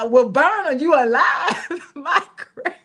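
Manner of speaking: slow, laughing disbelief; amused incredulous laughter. Real, not acted. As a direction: laugh at how absurd it sounds. Laughing at the exaggerated claim.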